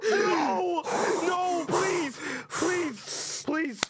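very high, loud pleading; panicked begging. Pretend. Player begging rival not to hit, high panicked wail. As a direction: wail for mercy.

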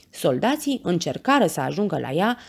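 plain reading voice

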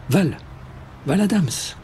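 high, calling through the door. A narrator's reading. The mayor at the door calling for Val. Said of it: "Une voix m'appela de l'extérieur".

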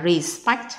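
respect, slowly, with the weight on spect; stress on the second syllable. Shown as a demonstration. Teacher shows students where respect is stressed, slow and clear. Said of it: "khi mình đọc, đọc là".

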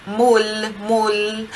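Mul with the tongue tip behind the front teeth; Italian L. Shown as a demonstration. Teacher modelling the Italian final L, slowly. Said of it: "A ponta da sua língua vai tocar ali".

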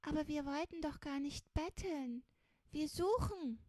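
high-pitched little protest; pleading correction; earnest protest. A narrator's reading. Child protests against being called beggar.

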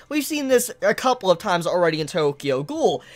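ordinary talk, nothing marked

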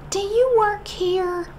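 very high, slow, drawn-out question; mock customer voice. Woman asking the kneeling clerk, high and slow. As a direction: stretch it out like a clueless lady.

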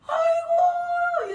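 long, high, drawn-out aigo wail; hammed-up cry of shame; mock wail. Wife wails in mock shame.

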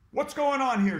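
high, loud stern teacher; barked demand. A narrator's reading. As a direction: bark the question. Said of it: "she barked".

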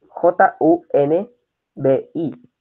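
each letter of J U N B I said apart, slowly; spelling out slowly. Shown as a demonstration. Teacher spelling yunbi out slowly for students.

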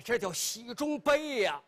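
plain reading voice

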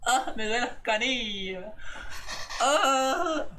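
high, laughing overacted groan; hammed-up dying moan. Creator mocks an overdone dying complaint about shins. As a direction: ham up an old woman dying of sore shins. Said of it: "Pero nadie".

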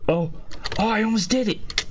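high excited yelp; thrilled near miss. Real, not acted. Nearly clears the level.

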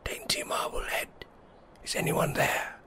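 faint, voiceless whisper; all breath, very soft; barely making any sound. A narrator's reading. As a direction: whisper a call that can hardly be heard. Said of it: "barely making any sound".

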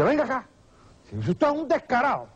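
high-pitched acted outrage; comic indignant accusation; indignant theater owner. Theater owner confronts swindler.

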